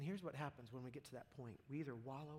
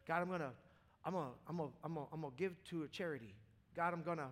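louder, rushed stammering plea to God; frantic bargaining; 3 semitones higher, 6 dB louder, faster than usual. Retold or imitated. Preacher acting out sinners bargaining with God, mock frantic plea. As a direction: tumble over the words like a desperate sinner. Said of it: "we go".

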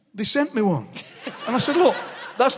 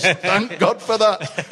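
slow, drawn-out relieved toff through a laugh; mock posh relief, laughing; much slower than usual. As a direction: stretch it out like a grateful toff. Toff voiced in story.

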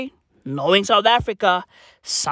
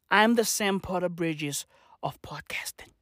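whisper; 4 semitones lower, 7 dB quieter than usual. Real, not acted. Boastful aside, dropped to whisper.

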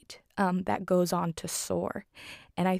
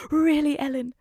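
high, louder put-on wife voice; mock an appalled reproach, pitched up; scolding Missus Banks; 8 semitones higher, 5 dB louder than usual. Distraught wife to her maid, high imitated reproach. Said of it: "Missus Banks is like".

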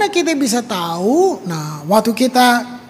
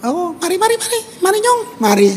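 God calling; 9 semitones higher than usual. Acted. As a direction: beckon gently in a high voice. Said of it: "Tuhan bilang". (memanggil lembut, nada tinggi)

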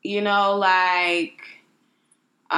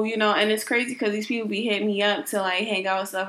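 ordinary delivery

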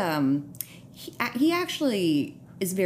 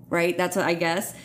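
usual speaking voice

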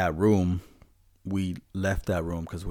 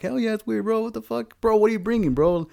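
very high, loud freaked voice; freaked-out exclamation; 5 dB louder than usual. Retold or imitated. Answering coworker about the weird day, very high freaked voice. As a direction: squeal in spooked disbelief.

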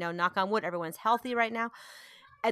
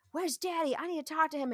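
higher, demanding kid imitation; dramatic oldest child; 7 semitones higher, 3 dB quieter than usual. Mom voices her oldest looking for dad, demanding imitation. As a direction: mimic an insistent child, pitched up. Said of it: "my oldest was like".

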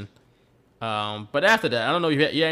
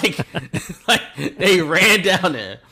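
laughing; 4 semitones higher, 5 dB louder, much slower than usual. Real, not acted. Laughing retelling.

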